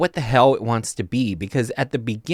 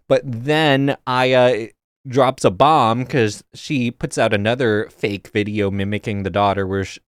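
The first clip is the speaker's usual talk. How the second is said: ordinary delivery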